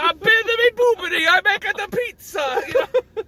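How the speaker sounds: high, loud cartoon Italian accent. Mario's dad defending himself, over-the-top Italian accent. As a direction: ham up the Italian gibberish. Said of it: "every Italian stereotype you've ever known".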